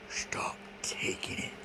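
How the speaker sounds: slow whispered command. Retold or imitated. Whispering the voices' command to stop his meds. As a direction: hiss it softly like a secret order. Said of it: "they're trying to say".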